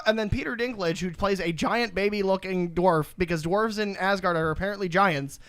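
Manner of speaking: ordinary talk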